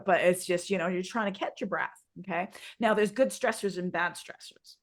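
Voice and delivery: ordinary delivery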